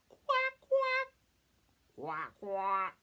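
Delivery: very high, loud quacks; squawky, high-pitched duck call; duck sound. Shown as a demonstration. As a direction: quack like a funny duck for kids to copy. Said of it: "Can you make a duck sound?".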